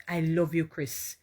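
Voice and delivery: ordinary talk, nothing marked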